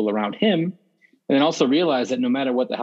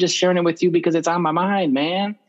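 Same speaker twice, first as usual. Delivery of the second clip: exasperated protest; 6 semitones higher than usual. Acted. Friend venting to an unasked adviser, exasperated. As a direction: protest like someone fed up with being advised. Voicing someone annoyed by advice.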